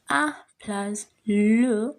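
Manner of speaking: A plus le drawn out slowly; very slow teaching voice; slow rule recital. Shown as a demonstration. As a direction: lay out the rule a word at a time. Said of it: "teacher explains contraction".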